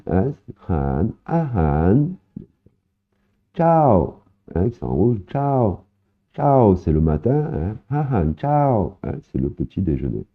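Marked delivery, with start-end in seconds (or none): 1.2-9.0 ciao with a high falling tone, much higher pitch; Thai tone demo. Shown as a demonstration. Teacher modelling the falling Thai tone of ciao. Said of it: "l'intonation qui monte et qui descend".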